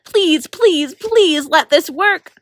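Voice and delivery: ordinary delivery, nothing marked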